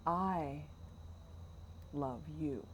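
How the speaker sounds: "I", a long pause, then very soft "love you"; stress on I. Shown as a demonstration. Host calling it almost a confession, quiet stress on "I". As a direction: let "I" stand alone, then softly add "love you". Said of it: "I suddenly gets really important".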